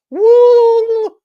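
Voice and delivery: high piercing loud woo; mock whoop. Acting out agents hauling him off, a loud whoop. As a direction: let out a shrill mocking whoop. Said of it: "start going like this".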